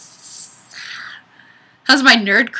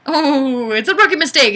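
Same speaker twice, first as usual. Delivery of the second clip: usual speaking voice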